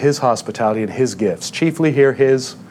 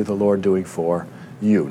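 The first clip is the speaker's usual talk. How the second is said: lower, softer, slower, landing on "you"; hushed repeat leaning on "you"; soft emphatic repeat; 5 semitones lower, 6 dB quieter than usual. Shown as a demonstration. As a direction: let "the Lord doing for you" sink in quietly. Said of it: "So what's more important".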